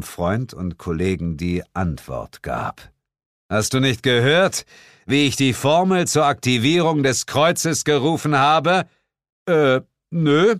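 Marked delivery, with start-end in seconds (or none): 3.5-8.9 high, loud boasting voice; indignant hero. Acted. Narrator presses Suko about the formula, indignant. 9.5-10.6 high blunt reply; offhand no. Acted. Suko dismisses the question, offhand.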